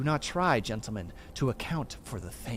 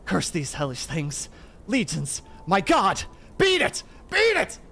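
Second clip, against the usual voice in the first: cry rising to shriek; 8 semitones higher, 7 dB louder than usual. Acted. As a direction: start contained, build to a very high, loud shriek. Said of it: "Here Warren's whisper swelled into a cry".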